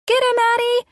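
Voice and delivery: put-on very high-pitched child cry; little boy's scream. A narrator's reading. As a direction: scream for help in a game. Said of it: "John screamed".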